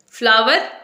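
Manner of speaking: फ्लावर as FLAA-ver, with v and final r; common mispronunciation. Shown as a demonstration. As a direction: ask फ्लावर with a rising v-sound "FLAA-ver?".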